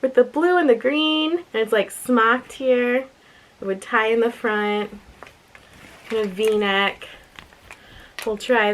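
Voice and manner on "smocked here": usual speaking voice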